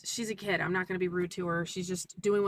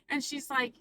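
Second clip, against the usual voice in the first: usual speaking voice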